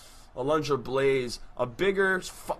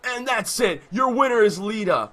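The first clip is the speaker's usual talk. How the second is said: higher, louder ring announcer call; winner announcement; 5 semitones higher, 4 dB louder than usual. Acted.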